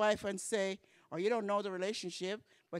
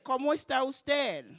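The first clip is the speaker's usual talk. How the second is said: high, loud call of ¿Cómo está usted?; ¿Cómo está usted? dicho alto y claro; loud model phrase; 6 semitones higher, 6 dB louder than usual. Shown as a demonstration. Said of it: "Let's say it".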